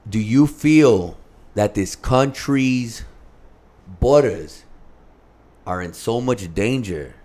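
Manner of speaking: measured phrases with long pauses; grave questioning. Retold or imitated. Dad testing his son about border patrol, grave and deliberate. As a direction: weigh each phrase and let it hang.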